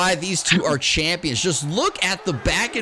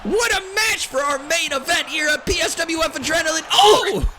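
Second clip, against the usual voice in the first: excited play-by-play calling; wrestling commentator; 7 semitones higher, 4 dB louder than usual. Acted. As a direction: shout the big moves live.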